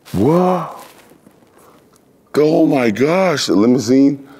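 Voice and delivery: high, slow amazed gasp; stunned at the limousine outside; surprised. Retold or imitated. Seeing the limousine, slow high astonishment.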